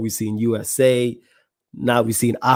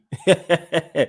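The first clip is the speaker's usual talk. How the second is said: laughs; 5 semitones higher than usual. Real, not acted. Laughing at own patois attempt.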